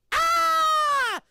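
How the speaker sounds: high, loud panicked scream; mock scream. Pretend anchor screaming between segments, high loud scream. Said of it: "running back and forth screaming".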